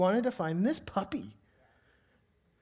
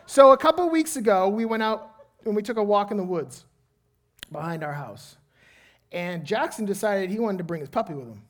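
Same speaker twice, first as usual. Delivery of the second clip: louder, higher preaching storytelling; animated pulpit storytelling; energetic sermon storytelling; 4 semitones higher, 7 dB louder than usual. Acted. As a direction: tell it to the whole room with drive.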